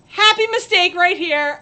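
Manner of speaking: ordinary talk, nothing marked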